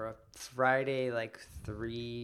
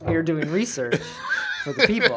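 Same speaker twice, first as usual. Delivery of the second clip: laughing mock pride; 8 semitones higher, 11 dB louder than usual. Mock self-important laughing claim.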